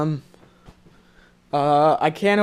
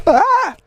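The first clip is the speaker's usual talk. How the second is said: high, loud yelp; playful shout; 6 dB louder than usual. Real, not acted.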